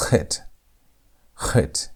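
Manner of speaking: "Chet" begun between a K and an H; guttural CH. Shown as a demonstration. Teacher models the letter for students, guttural CH. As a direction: say "Chet" with the CH of Bach. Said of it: "in between the K and the H sound".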